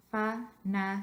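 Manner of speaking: fanático split evenly into fa, na, ti, co; even, separated fa-na-ti-co; syllable by syllable. Shown as a demonstration. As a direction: sound out fanático one syllable at a time. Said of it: "put your voice stress on the last syllable".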